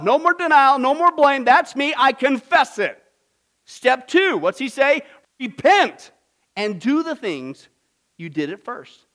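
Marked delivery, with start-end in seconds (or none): none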